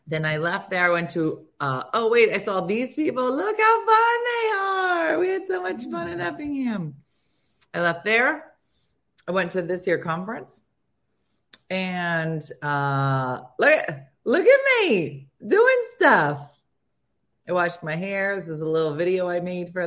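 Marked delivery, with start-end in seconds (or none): 3.3-6.9 excited shout. Real, not acted. Showing photos, exclaiming. 13.6-16.5 high, slow, louder brag; mock-proud look at me; playful showing off. Real, not acted. Showing off her own photo.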